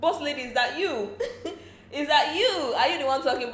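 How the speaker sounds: high, laughing mock disbelief; amused housemates teasing boss lady; laughing tease. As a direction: tease her through laughter. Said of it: "People are like".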